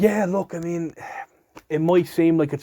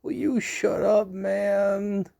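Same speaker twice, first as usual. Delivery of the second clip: Joe Biden; 7 semitones higher, much slower than usual. Retold or imitated. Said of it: "He's like".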